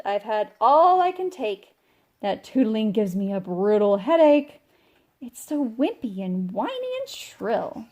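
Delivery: loud, fed-up rant; angry complaint. Acted. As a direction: rail against the flute.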